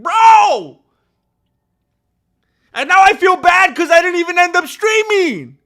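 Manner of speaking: loud, high bro; overwhelmed shout. Real, not acted. Streamer reacting to a donor's extra gift, loud. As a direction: blurt it out, stunned and grateful.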